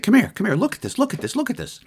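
high-pitched, urgent retold summons; excited calling over. Teller calls Kathleen out to see the triangle, excited. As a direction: call them over excitedly, voice jumping high.

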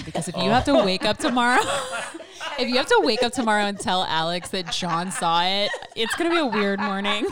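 words through giggles; laughing while talking. Real, not acted. Laughing through the retold warning.

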